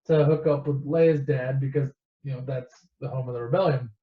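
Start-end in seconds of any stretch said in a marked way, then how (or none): none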